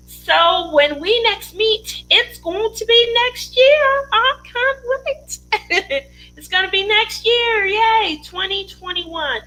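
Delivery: high, slow excited squeal; thrilled. Real, not acted. Host to viewers, squealing with excitement about next year.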